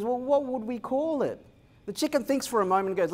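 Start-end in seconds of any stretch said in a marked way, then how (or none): 0.0-1.4 pig voice. Acted. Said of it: "The pig goes".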